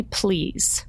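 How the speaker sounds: ordinary delivery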